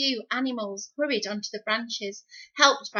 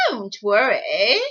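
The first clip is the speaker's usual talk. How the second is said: alligator; 8 dB louder, slower than usual. Acted. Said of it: "said the alligator".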